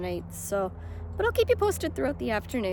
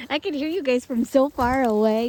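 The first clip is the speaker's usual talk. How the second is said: calling out; 4 semitones higher, 11 dB louder than usual. Real, not acted. Greeting family, calling out.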